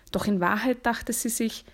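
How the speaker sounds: ordinary delivery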